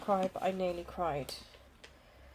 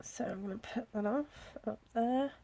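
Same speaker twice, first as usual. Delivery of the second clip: whispering; 2 semitones higher, 8 dB quieter than usual. Real, not acted. Quiet aside while sticking planner.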